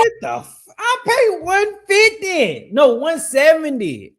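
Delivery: high, stretched-out outrage at his own bill; real shock, voice jumping way up; shocked disbelief. Real, not acted. Streamer comparing his phone bill, shocked.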